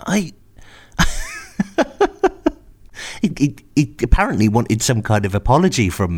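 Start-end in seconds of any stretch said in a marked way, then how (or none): 1.0-2.5 high-pitched laugh in a pulsing run. Real, not acted. Storyteller laughing at driver's complaint, high helpless giggle. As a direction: break off into squeaky laughter.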